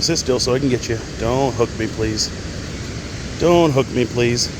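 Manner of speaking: normal talking voice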